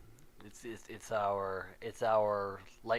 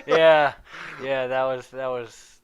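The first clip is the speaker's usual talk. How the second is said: laughing; 13 dB louder than usual. Real, not acted. Laughing at dog joke.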